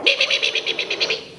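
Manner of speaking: unvoiced scolding me me me; mock angry mom. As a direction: imitate mom's mad nagging. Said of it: "she'd get all mad and go".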